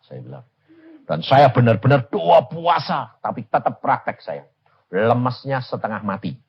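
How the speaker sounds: ordinary talk, nothing marked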